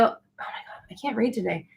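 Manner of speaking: real embarrassed whisper to herself; whispered aside. Real, not acted. Host whispering to herself after stumbling over a viewer's question. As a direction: breathe it under your breath, half laughing at yourself.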